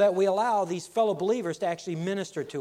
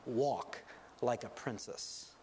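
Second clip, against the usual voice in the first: softer, lower queen leaning in to her daughter; a queen's quiet aside to her daughter before she speaks; hushed reminder; 4 semitones lower, 8 dB quieter than usual. Retold or imitated. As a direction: murmur it close to her ear, slowly. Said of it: "the queen leans over and says".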